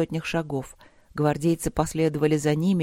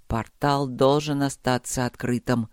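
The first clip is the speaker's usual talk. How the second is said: put-on low, measured old ruler; solemn, lowered voice; dying emperor, resolute; 5 semitones lower than usual. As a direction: give final wishes gravely. Dying emperor speaks at the portal.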